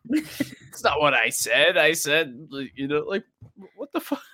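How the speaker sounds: high, half-laughing protest; mock indignant dad. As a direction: imitate a flustered dad objecting, amused. Acts out dad scolding his kid.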